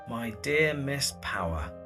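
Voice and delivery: normal talking voice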